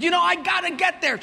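demanding passenger. Retold or imitated. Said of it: "She said".

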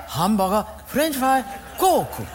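put-on light Japanese accent, "Coke" as "koku"; "hamburger" said "hambaga", Japanese accent. Ordering breakfast from the waitress, Japanese accent. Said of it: "Japanese guy".

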